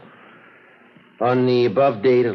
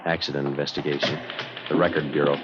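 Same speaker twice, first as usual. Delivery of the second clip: low, dry first-person narration; detective narration; 4 semitones lower than usual. A narrator's reading. Friday narrating his night watch to listeners, low and dry. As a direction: lay out the facts like a tired cop.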